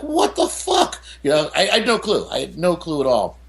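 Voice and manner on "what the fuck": shocked disbelief. Retold or imitated. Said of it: "I was like".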